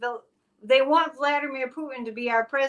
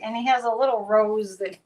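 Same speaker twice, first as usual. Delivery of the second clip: ordinary delivery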